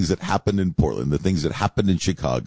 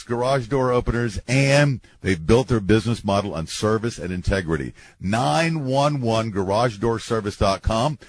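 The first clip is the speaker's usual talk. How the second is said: radio ad read; 4 semitones higher than usual. Acted. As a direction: sell it like a local radio spot. Reads a radio ad.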